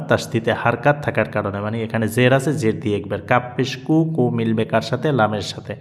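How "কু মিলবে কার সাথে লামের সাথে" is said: usual speaking voice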